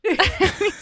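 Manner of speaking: ordinary delivery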